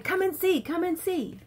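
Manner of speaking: high-pitched imitation of eager coaxing; eager beckoning. Wife imitating husband calling her to see, eager.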